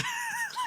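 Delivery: high laugh at his own story. Real, not acted. Laughing while recounting his cinema outburst, amused. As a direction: break into a high, brief laugh.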